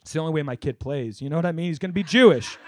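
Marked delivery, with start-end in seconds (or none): none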